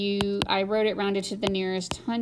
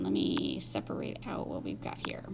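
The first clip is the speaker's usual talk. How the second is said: whispering; 7 dB quieter than usual. Real, not acted. Whispered aside.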